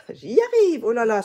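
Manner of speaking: high, loud burst of playful frustration; real self-mocking exasperation. Real, not acted. Vlogger tripping over words in front of viewers, loudly exasperated. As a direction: exclaim at your own fumbling. Exasperated at own verbal stumbles.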